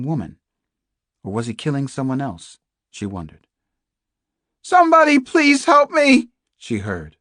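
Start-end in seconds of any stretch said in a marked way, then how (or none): 4.6-6.3 high, full-voiced scream for rescue; screaming for help. Captive woman calling through the house for rescue, screaming. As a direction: scream it at the top of your voice. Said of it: "screaming at the top of her voice".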